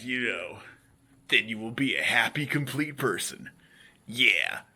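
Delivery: usual speaking voice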